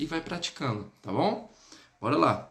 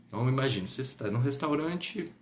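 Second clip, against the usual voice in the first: usual speaking voice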